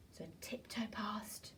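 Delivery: hushed tiptoeing whisper, slowed; a sneaky storyteller's whisper. Acted. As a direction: breathe it so the dog stays asleep. Sneaking past sleeping dog.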